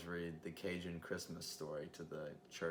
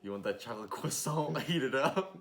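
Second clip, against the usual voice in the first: loud, laughing barista with French croissant; playful French croissant; 7 semitones higher, 8 dB louder than usual. Retold or imitated. Barista offering to heat the croissant, loud mock-French flourish. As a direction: build louder and higher on the joke. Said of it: "And I go".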